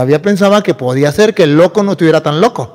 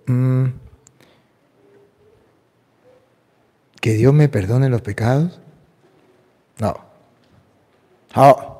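quiet; 4 semitones lower, 6 dB quieter, slower than usual. Retold or imitated. Madman refusing forgiveness, low voice.

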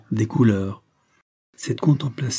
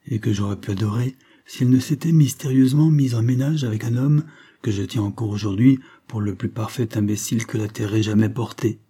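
usual speaking voice

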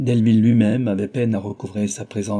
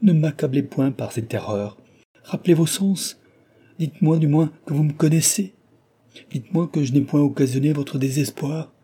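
raised, pleading cry of a frightened lover; desperate plea, louder and higher; anguished exclaiming; 5 semitones higher, 3 dB louder than usual. A narrator's reading. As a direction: beg her at her feet, voice climbing. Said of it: "s'écria-t-il en se précipitant à ses pieds".

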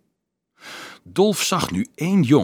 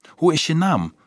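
higher-pitched, gentle character question; 7 semitones higher, 3 dB louder than usual. A narrator's reading. Dolf asks the unknown girl her name, questioning. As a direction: ask it with a lifted pitch.

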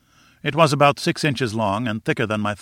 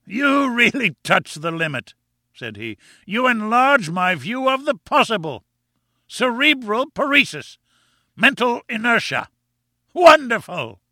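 much higher, mocking professor voice; scornful professor; 12 semitones higher than usual. A narrator's reading. Professor mocking the narrator's dullness, pitched high. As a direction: sneer at his dim guest.

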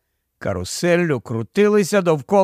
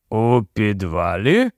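surprised echo; 7 semitones lower than usual. A narrator's reading. Policeman echoes in surprise.

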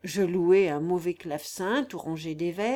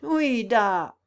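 a jeering call from afar, a bit louder; mocking call; 4 dB louder than usual. A narrator's reading. Wigmaker Keller spots the boy from afar, jeering call. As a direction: hail him with a sneer. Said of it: "me cria-t-il d'aussi loin".

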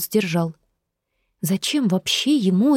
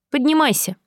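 barked order; 8 semitones higher than usual. Acted. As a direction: snap the order at her. Guard orders prisoner up.

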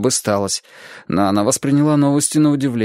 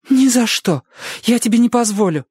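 high, louder desperate refusal; protesting; 12 semitones higher, 3 dB louder than usual. A narrator's reading. Girl refusing sister, high desperate protest. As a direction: fight for the cat with everything.

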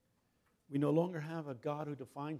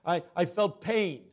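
ordinary delivery

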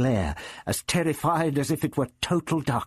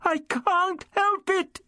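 very high, drawn-out fearful wail; half sobbing, afraid; slower than usual. A narrator's reading. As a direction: plead helplessly on the edge of sobbing. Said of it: "half sobbing with fear".

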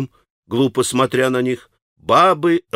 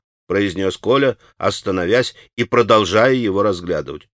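plain reading voice

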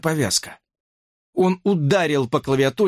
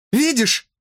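high-pitched exclamation; exclaiming; 11 semitones higher than usual. A narrator's reading. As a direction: cry it out as a startled question. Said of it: "воскликнула Брук". (восклицая)

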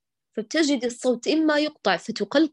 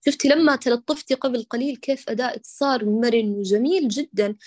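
ordinary talk, nothing marked

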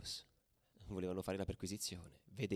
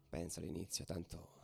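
ordinary talk, nothing marked

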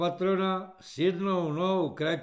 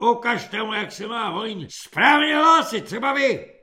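raised, loud commanding voice; hollered order; 5 semitones higher, 9 dB louder than usual. A narrator's reading. Corporal picking a recruit to demonstrate, hollering. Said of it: "zahulákal svobodník".

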